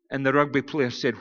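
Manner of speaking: ordinary talk, nothing marked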